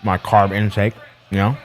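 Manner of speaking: talking with mouth full; words round a bite of steak; chewing steak. Real, not acted. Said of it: "I can't even talk right now".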